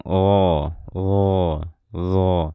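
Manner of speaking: RO with the r left hovering, drawn-out rounded "oh"; floating trill. Shown as a demonstration. Teacher showing how not to say ro, r left floating. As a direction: let the r of RO float, held long and level. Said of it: "getarannya ngambang".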